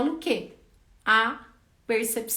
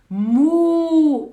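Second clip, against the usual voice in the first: loud, higher, long "mu"; forceful moo; 6 semitones higher, 11 dB louder than usual. Shown as a demonstration. Therapist showing a child a strong moo.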